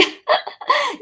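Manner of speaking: very high squealing laugh. Real, not acted. Laughing at Yati's joke, high real laughter. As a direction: let the laugh fly up high. Laughing at her friend Yati.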